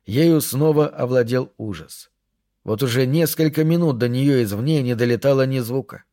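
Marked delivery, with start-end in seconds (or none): none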